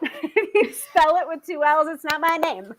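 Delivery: high mock outrage breaking into laughter; playfully indignant correction; mock angry. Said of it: "I get very angry when people misspell my name".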